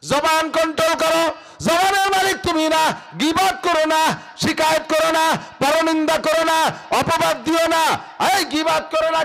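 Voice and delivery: high, loud preaching cry; fiery sermon. Acted. Preacher thundering at the mosque committee, loud and high. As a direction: lift the voice and hammer it at the crowd. Waz preacher at full force.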